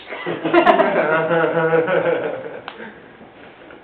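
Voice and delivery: loud burst of laughter. Real, not acted. Speaker laughs at his [name] punchline. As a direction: laugh at his own joke.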